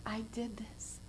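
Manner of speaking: hushed, fearful admission; whispered confession. Acted. Recovering women confessing to Jesus, whispered. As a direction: whisper it like a secret shame.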